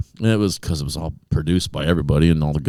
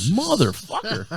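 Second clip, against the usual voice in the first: high, drawn-out mock dismay; exasperated curse; 4 dB quieter, slower than usual. Cursing after the manager's order, high exasperated drawl. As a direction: stretch the curse out in high frustration.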